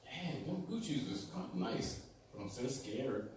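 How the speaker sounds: put-on soft, hushed, timid girl; Dorothy voice. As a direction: breathe it like a frightened girl. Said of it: "And Dorothy says".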